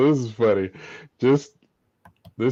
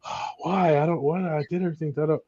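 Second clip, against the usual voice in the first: whining son; 8 semitones higher, faster than usual. Retold or imitated. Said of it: "And he was like".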